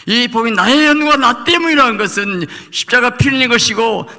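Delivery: 높고 크게 몰아치는 설교; 목청 높여 회중을 몰아붙이듯; 열정적 설교. Acted. (십자가를 전하며 높이 외치는 설교)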